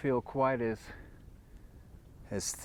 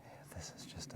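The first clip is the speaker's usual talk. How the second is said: hushed, awed whisper; 3 semitones lower, 17 dB quieter, faster than usual. Real, not acted. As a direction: breathe it out in wonder. Awed by the huge waste rock.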